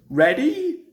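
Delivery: checking before the push; calling out. Acted. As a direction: shout the question ahead. Duck calls before pushing.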